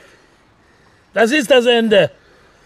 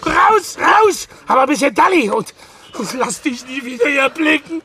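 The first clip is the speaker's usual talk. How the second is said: shouting; 8 semitones higher than usual. Acted. Throws friend off the balcony.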